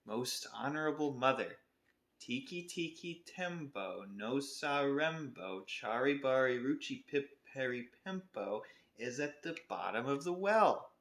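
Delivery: slow, careful recital of the long name; slow, deliberate telling; very slowly. Acted. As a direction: drag out each syllable. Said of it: "and slowly, very slowly".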